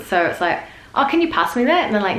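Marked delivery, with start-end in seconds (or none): 0.9-1.8 high, sweet request; asking nicely. Shown as a demonstration. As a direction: ask a favour high and nicely. Demo of asking a favour.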